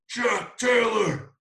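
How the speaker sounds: long, drawn-out loud holler; high shout, slowly stretched; yelled name. Retold or imitated. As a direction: call a friend from down on the court. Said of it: "Why is he yelling my name".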